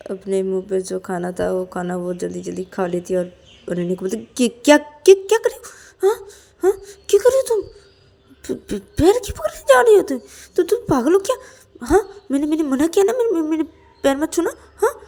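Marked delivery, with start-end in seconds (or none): 4.2-15.0 very high, loud flustered stammer; alarmed protest. Acted. Payal stops Ananya touching her feet, alarmed and stammering. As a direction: protest in shock, tripping over words.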